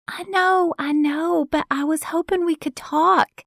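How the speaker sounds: put-on high pleading young woman. Kiki voiced higher.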